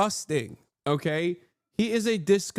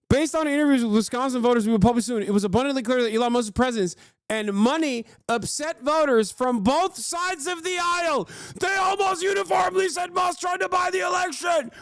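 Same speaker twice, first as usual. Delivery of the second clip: higher, mock news-analyst voice reading a report; mock pundit read; 9 semitones higher than usual. Streamer reading a news report to chat, in a mock pundit voice. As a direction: ham up the pollster findings like a TV pundit. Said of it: "this is why you pay me the big bucks".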